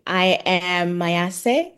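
ordinary delivery

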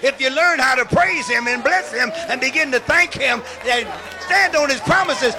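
loud, driving sermon delivery; full-force preaching. Real, not acted. Healed bishop testifies to congregation, loud exhorting preaching. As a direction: punch each phrase out to the crowd.

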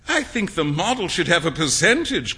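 ordinary delivery, nothing marked

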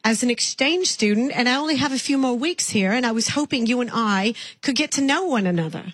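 lightly put-on English accent calling a stranger "love"; sweet English caller, accent worn lightly; British accent. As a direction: play a chatty Englishwoman with a mild accent. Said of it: "I'm from England".

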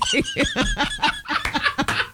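high, squealing laugh; high burst of laughter. Real, not acted. Laughing at dad's remark to her cohost, high squeal.

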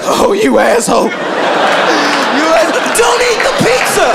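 high shouted mock outrage. Pizza lover insulting the guy offering pizza, mock angry shout. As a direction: yell it in hammed-up indignation. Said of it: "You're not gonna be like".

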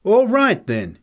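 loud, high burst of menace; angry threat. Acted. Fighter squares up to Nick, loudly menacing. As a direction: accept the fight angrily, pitch leaping.

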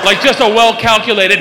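ordinary talk

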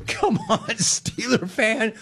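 high, laughing jab at the Steeler fan; laughing tease. Real, not acted. Host laughing as he teases Steeler fans, giggly mock sympathy. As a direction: laugh it out while you needle them.

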